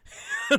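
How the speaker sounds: a sudden loud unvoiced burst of laughter; enthusiastic laugh. Real, not acted. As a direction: explode into a big, delighted laugh. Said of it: "my enthusiastic laugh".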